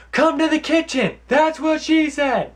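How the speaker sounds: shrill high shout of the punchline, slowed at the end; annoying joke-teller. Pretend. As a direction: yell it like the cringey friend who thinks it's hilarious. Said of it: "Be like".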